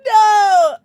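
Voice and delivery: loud, high wail; big high-pitched shout; crying out. Real, not acted. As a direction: wail it out, overcome. Crying out, overcome by tears.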